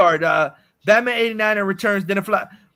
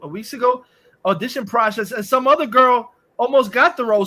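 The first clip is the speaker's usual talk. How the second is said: ordinary talk, nothing marked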